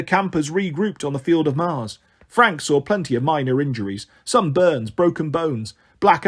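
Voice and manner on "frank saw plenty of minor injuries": usual speaking voice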